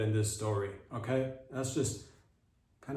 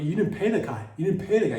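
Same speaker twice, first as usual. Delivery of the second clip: loud, higher-pitched scolding; indignant rebuke; 6 semitones higher, 7 dB louder than usual. Acted. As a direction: call them out, loud and outraged.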